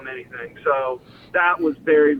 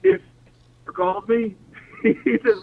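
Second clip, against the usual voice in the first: usual speaking voice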